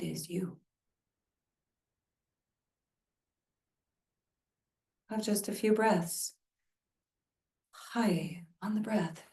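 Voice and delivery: calm guiding voice for breath practice; guided meditation. Acted. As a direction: guide them into stillness. Leads a meditation.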